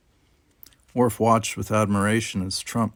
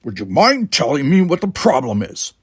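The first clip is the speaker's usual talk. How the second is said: louder, higher irritated demand; 5 semitones higher, 7 dB louder than usual. Acted. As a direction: confront her, annoyed. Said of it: "said in irritation".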